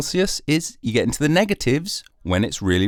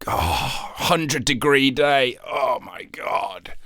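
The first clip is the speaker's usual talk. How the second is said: mock awe; 5 semitones higher, much slower than usual. Mock Fahrenheit user, hot day.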